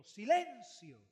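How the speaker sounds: loud command to the storm; commanding the wind. Retold or imitated. Jesus commands the wind, loud and firm. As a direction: order the wind to be still. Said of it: "le dice al viento".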